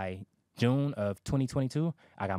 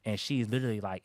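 ordinary talk

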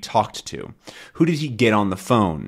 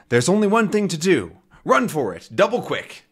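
voiced Tintin; 8 semitones higher than usual.

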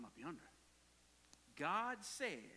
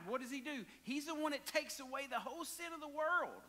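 usual speaking voice

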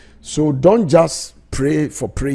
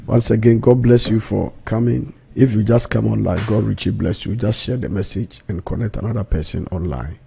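usual speaking voice

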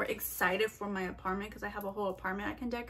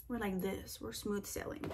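smooth and soft; 8 dB quieter than usual. Shown as a demonstration. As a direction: drop much softer, calm and even.

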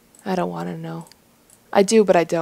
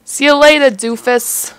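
higher, louder jeering send-off; mocking goodbye; 5 semitones higher, 4 dB louder than usual. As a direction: toss it out loud and high as an insult.

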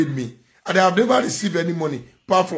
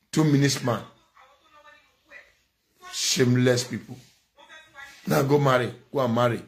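normal talking voice

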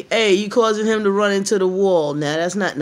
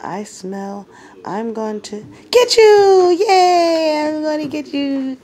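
much higher, louder squeaky puppy voice; playful puppy voice; 11 semitones higher, 6 dB louder than usual. Pretend. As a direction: play the excited little dog taunting its buddy. Owner voices dog playfully.

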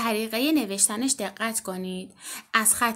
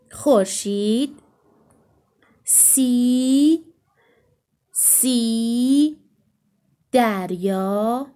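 slow drawn-out word; 4 dB louder, much slower than usual. Shown as a demonstration. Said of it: "اس مثل چی؟".

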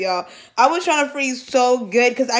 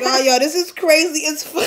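real laughing fit, voice pitched up; laughing to tears; 5 semitones higher than usual. Real, not acted. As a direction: talk while cracking up. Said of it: "Why am I crying this hard?".

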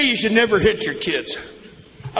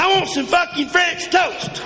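put-on kid's demand; 8 semitones higher than usual. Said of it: "He says".

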